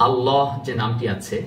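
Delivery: ordinary delivery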